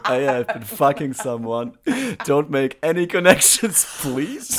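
high, loud mocking impression; mocking imitation. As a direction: squeal it in a mock voice of her. Mocking co-host's words, high, loud.

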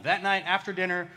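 normal talking voice

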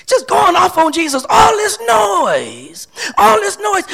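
high, loud preacher's cry; exasperated outcry. Acted. Preacher voicing all the noise around Jesus, exasperated outcry.

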